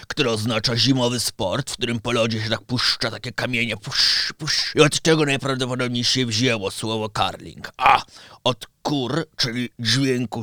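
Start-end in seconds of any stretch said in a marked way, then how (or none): 3.8-4.7 hushed "push, push" swooshes; voiceless little pushing swishes; imitated sliding noise. Shown as a demonstration. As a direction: mimic stones sliding on ice. Imitates sliding curling stones.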